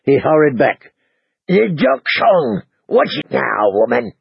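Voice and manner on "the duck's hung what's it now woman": lightly voiced grumbling innkeeper; a touch of a put-upon husband; grumpy husband.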